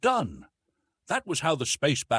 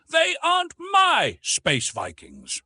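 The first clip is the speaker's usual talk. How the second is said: indignant shout; 6 dB louder, slower than usual. Acted. Indignant denial to the prince.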